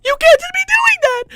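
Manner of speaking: outraged shout. Real, not acted. Streamer ranting to viewers about the salute, high outraged shout. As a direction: yell it in disbelief.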